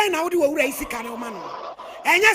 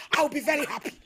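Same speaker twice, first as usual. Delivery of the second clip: usual speaking voice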